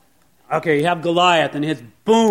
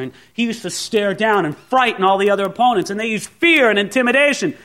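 ordinary delivery

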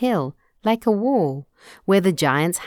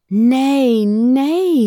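loud refusal; 5 semitones higher, 6 dB louder than usual. Acted. Father refuses son's leave.

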